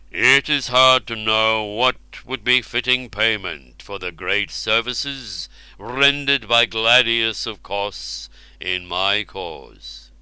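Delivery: slow, grand royal voice; royal king voice. Acted. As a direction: speak slowly like a king. Ubar speaks.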